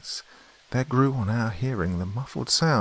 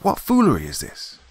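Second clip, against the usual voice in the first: higher, indignant challenge; raised-voice challenge; calling out; 7 semitones higher, 4 dB louder than usual. A narrator's reading. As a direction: call out a puzzled demand. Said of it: "I called".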